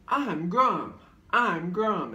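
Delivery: high, loud cartoon voice; Grum, animated character. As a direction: sing out the name as a goofy animated character. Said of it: "who's like".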